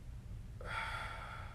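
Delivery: slow breath let out through the mouth; long exhale. Shown as a demonstration. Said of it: "and breathing out".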